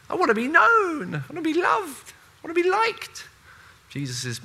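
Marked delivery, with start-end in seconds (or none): 0.1-3.3 much higher, pleading voice of someone craving fame; mock needy crowd. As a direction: whine it like an attention seeker. Voicing everyone's longing to be famous.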